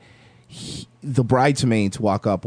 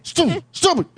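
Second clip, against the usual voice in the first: very high, urgent hushed mom; squeaky, stifled nagging; scolding mom; 14 semitones higher than usual. Retold or imitated. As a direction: imitate mom hissing as she pinches dad. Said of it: "pinching his leg".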